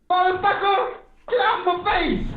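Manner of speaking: high, rough, snapping put-on voice; gruff put-on voice. Streamer voicing Syshet telling him to get lost. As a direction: bark it like a grumpy old comic.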